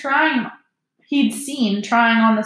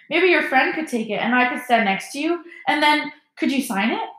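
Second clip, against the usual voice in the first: higher-pitched, hopeful pleading; imploring boy; 6 semitones higher than usual. A narrator's reading. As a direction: ask timidly, rising at the end. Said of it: "He looked imploringly at Harry".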